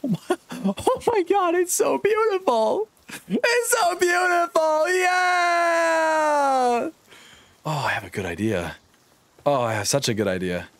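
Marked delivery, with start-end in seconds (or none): 0.0-4.9 squealing joy. Real, not acted. Overjoyed at the built house. 4.9-6.9 high cheer. Real, not acted. Cheering his build.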